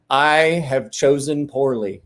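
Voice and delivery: slow, measured mock regret; deliberate, comic admission of a mistake; rueful realization. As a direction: draw each word out as it dawns on you. Retold regret of bar-fight opponent.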